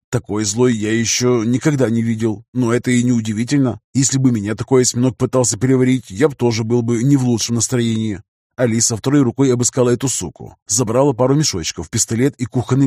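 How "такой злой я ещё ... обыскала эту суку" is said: ordinary delivery, nothing marked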